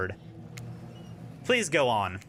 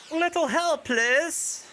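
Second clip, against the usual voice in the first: much higher, bright call for help; huntress calling out; 11 semitones higher, much slower than usual. Acted. Huntress asking the stranger for the ball, high light call. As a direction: call over sweetly from the volleyball court. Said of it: "I don't know how to do a Russian accent very well".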